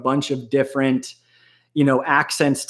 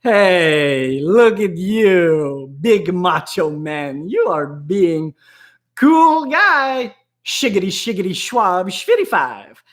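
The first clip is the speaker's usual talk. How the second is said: slow, drawn-out silly voice rising much higher on cool guy; mocking macho man voice; 3 semitones higher, slower than usual. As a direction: tease someone acting tough in a cartoon voice. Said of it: "I'm like".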